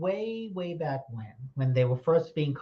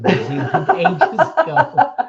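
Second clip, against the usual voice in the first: laughter rising to a high, loud burst at the end; giggling through the joke, going up and loud; laughing while reading; 11 semitones higher, 12 dB louder, slower than usual. Real, not acted. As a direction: read it out and break into laughter. Laughs reading chat comment.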